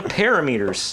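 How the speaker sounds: long EE in "parameters"; mispronounced parameters. Retold or imitated. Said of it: "and I'm like".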